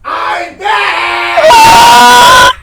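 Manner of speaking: high drawn-out shout; gleeful return. Acted. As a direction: announce your return in a long, high holler. Captor bursts back in.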